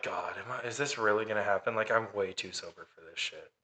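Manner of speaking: muttered inner thought. Retold or imitated. Facing the brother up close, muttered disbelief. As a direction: grumble it under your breath. Thinking as the guy gets close.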